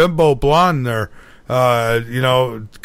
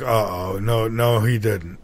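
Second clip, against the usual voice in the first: worried mutter; 6 semitones lower, 5 dB quieter than usual. Retold or imitated. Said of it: "I could see the worry".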